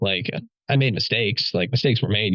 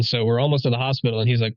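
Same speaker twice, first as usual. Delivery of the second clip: usual speaking voice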